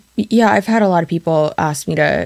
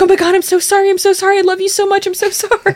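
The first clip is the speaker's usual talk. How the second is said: put-on high, gushing baby-talk apology to a dog; high doting pet voice, gushing sorry; apologetic to dog; 14 semitones higher than usual. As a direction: coo a frantic sorry to your dog. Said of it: "you lean down and you're like".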